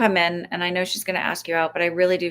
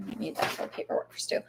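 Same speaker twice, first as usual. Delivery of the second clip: whispered aside; 11 dB quieter than usual. Real, not acted. Whispered aside to someone off-mic.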